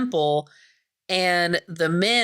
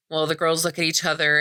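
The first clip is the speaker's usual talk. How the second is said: ordinary delivery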